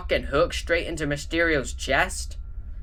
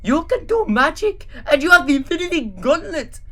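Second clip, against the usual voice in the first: put-on higher, brighter kid brother voice; Drew's voice; 5 semitones higher than usual. Drew pleading with his brother Gru. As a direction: play the eager little brother.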